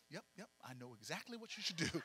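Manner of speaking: a hint of a wise old woman teasing. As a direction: build suspense quietly. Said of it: "she looks at me and she goes".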